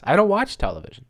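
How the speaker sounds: proud declaration. Retold or imitated. Vlogger reliving his grocery comeback, smug and quick. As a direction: toss the line out smugly. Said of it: "That's what I said".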